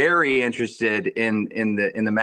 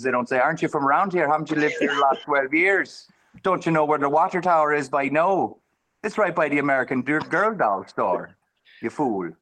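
put-on Irish brogue, running on merrily; West Dublin accent; 3 semitones higher than usual. Shown as a demonstration. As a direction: play an out-of-towner with an Irish brogue. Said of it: "in a fine West Dublin accent".

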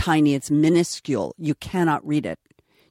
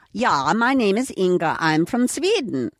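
put-on lilting Swedish accent, pitched up; comic Swedish accent; 5 semitones higher than usual. Actress re-enacting the first take as Inga, comic Swedish accent. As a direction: ham up a Swedish accent, drawing out the last word.

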